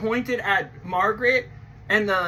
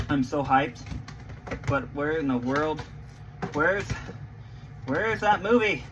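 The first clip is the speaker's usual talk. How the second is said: ordinary delivery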